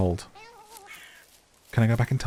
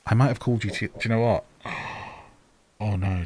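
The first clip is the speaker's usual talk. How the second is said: ordinary delivery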